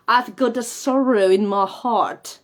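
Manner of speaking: slow phrase landing on "heart" with open back A; pija A. Shown as a demonstration. Teacher using it in a sentence, "heart" slow with back A. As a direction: draw out "heart" with no R. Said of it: "por ejemplo".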